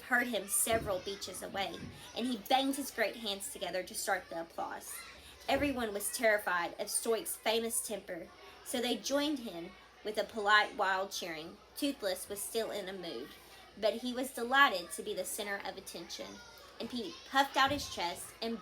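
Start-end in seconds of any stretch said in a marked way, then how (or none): none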